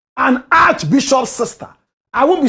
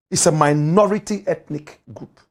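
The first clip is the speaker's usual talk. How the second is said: ordinary delivery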